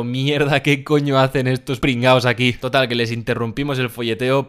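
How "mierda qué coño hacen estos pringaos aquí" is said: imitating a guy caught off guard, slightly louder; startled mock reaction. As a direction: act out mildly startled swearing at intruders. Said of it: "con los ojos súper abiertos, del palo".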